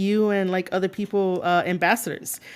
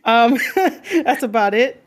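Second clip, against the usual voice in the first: laughing through the words; 5 semitones higher, 5 dB louder, slower than usual. Real, not acted. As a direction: chuckle it out, amused. Laughs after own joke.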